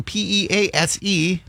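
letters P E A S E spelled out slowly, one by one; slowly spelling each letter. Shown as a demonstration. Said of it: "spelling the word".

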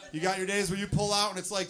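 ordinary delivery

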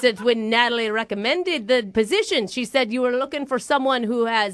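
mock-Irish job applicant; put-on Irish accent. Applicant citing her referral in a put-on Irish accent. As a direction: plead your case in fake Irish. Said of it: "my grandpappy from Ireland coming through".

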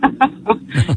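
short, soft laugh; quiet real chuckle; nervous laugh. Real, not acted. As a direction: let out a small laugh after the confession. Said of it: "the fact that you laugh".

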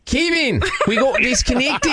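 put-on laughing through an Aussie accent; hammy Aussie, cracking up; Australian accent. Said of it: "using my Australian accent".

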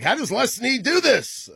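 exasperated rival teams. Retold or imitated. Host voicing the rest of the league, whining high and slow. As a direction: whine it like a beaten rival GM. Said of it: "and they're like".

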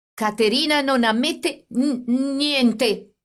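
high, slow, trembling stammer. A narrator's reading. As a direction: stumble over the words in fear and anger. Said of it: "egli disse balbettando".

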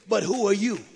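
loud, forceful demon retort; demon's challenge. Retold or imitated. Demons answer the would-be exorcists, loud challenge. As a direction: throw the question out hard and loud. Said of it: "the demons spoke back to the man".

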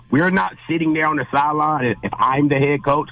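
usual speaking voice

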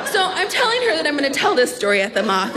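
normal talking voice

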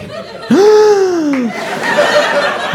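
long, high comic gasp; horrified gasp. Acted. Comic realises who is calling, long horrified gasp. Remembers who random guy is.

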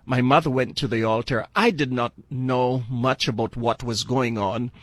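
usual speaking voice

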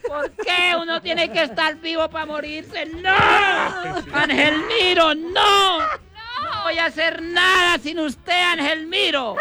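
high, crying, shouted lament; hammed-up wailing widow. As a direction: overact a widow sobbing over the coffin. Widow wailing over coffin in joke.

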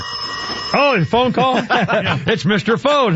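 slow, chuckling through the words; amused greeting to the phone; laughing. Real, not acted. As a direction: laugh as you name him. Host laughs at phone caller.

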